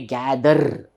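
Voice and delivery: "gather" with a rolled, sounded final r; Indian-style "gather", ending on a clear r; every letter pronounced. Shown as a demonstration. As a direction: let the r ring at the end of "gather". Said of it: "generally we all Indians".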